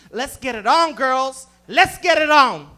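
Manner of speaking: loud, building to a shout; rally them with cocky excitement; eager come-on. Acted. Excitedly propositions both women.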